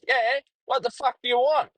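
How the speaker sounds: retold snap back. Blocked driver answering the radio caller, mock angry. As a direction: bite back like you're fed up. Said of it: "And I'm like, goes".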